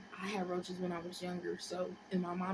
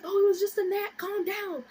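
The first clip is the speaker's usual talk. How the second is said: high, loud mimicked mom; impatient mother dismissing the panic; mock mom telling off a child; exasperated mom; 11 semitones higher, 8 dB louder than usual. Mom voiced calming her down.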